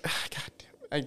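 exasperated whisper. Real, not acted. Exasperated at slow talkers.

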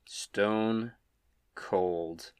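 ordinary talk, nothing marked

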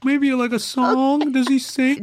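high, loud put-on musing; mock self-serving pitch. Actor slipping a song in for own character, mock self-serving pitch. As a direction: float the idea high, drawn out and loud.

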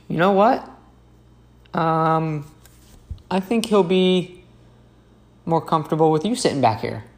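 hint of a coworker passing off the patient, slyly; partner's sly suggestion. Partner tells me to sit with patient, a hint of sly teasing. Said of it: "she turns to me and she goes".

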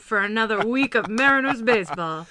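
loud, pumped-up mock hype; playful sports-promo push, loud. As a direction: hype up the crowd, tongue in cheek. Winding up mock hype while co-host laughs.

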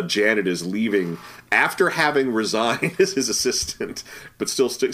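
normal talking voice

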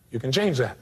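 usual speaking voice

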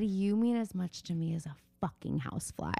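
a low, soft mock-rude voice; mock rude man. Friend mock-voicing the rude driver, low and dismissive. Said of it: "He said".

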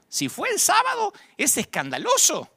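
high-pitched mock outrage; mock scandalized. Preacher mimicking critics of a Sabbath healing, scandalized.

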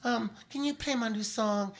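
sweet and high, playing the star asking a favor. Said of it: "I would do a voice".

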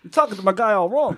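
high, put-on Walken voice; Christopher Walken impression. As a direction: give it your best Walken. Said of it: "Who can do the best Walken in here?".

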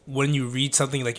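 ordinary delivery

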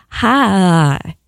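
Southern Virginia accent, Hi drawn out into four syllables; Danville Virginia drawl. Shown as a demonstration. Dad's hometown Danville, Virginia, Southern accent demo. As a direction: stretch Hi long like a Danville Virginia local. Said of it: "they say it like".

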